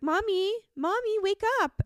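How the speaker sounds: put-on high little-kid voice calling Mommy; squeaky toddler pleading to wake up. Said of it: "he was like".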